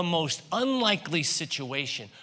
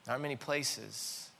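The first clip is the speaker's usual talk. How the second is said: soft, low, hushed sermon; quiet reflective preaching; 4 semitones lower, 6 dB quieter than usual. Real, not acted. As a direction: draw them in with a soft, low voice.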